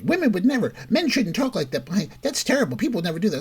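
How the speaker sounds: high-pitched mock outrage; mock-scandalized, pitched well up; mimicked outraged woman. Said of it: "She says".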